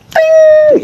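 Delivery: high sung ting like a bell; bright vocal chime effect; mock magic chime. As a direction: ring it out like a fairy-tale wish chime. Magic wish granted chime.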